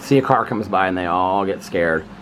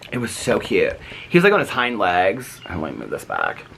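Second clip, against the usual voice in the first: ordinary talk, nothing marked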